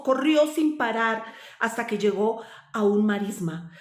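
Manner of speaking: ordinary delivery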